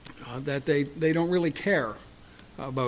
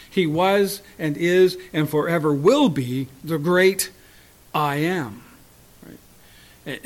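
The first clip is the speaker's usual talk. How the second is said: ordinary talk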